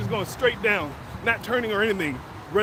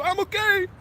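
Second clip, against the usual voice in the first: high, chirpy reassurance; the son, voiced high, calling out he's fine; brother voice; 12 semitones higher than usual. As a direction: pipe up brightly that you're fine. Brother getting up after crash.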